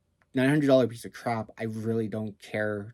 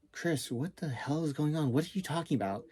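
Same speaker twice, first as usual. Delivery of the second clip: lightly voiced just-woken friend, a bit higher and softer; a half-asleep friend, confused, only a hint of a different voice; groggy friend; 4 semitones higher, 6 dB quieter than usual. Friend woken by a late call, a lightly voiced sleepy confusion. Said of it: "she's probably just waking up thinking".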